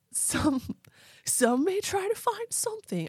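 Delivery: high surfer bro voice. Acted. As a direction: lift it like a chill beach dude. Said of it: "when I do this voice".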